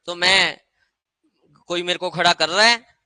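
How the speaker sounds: ordinary talk, nothing marked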